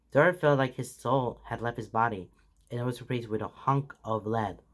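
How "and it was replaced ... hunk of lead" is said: plain reading voice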